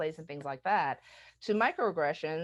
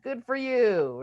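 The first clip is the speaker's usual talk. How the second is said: higher, louder, mock-sweet congratulation; patronizing praise; 6 semitones higher, 6 dB louder, slower than usual. Someone complimenting older folks on gadgets, condescending. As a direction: praise them like a small child.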